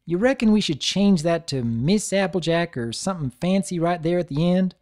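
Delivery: bashful country pony asking; shy folksy question; lightly voiced country mare; Applejack voice. Applejack asks shyly.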